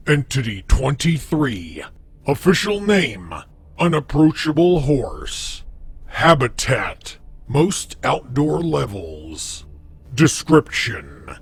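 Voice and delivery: measured, formal reading of a creepy database file; documentary entry read. Acted. Reads a Backrooms database entry.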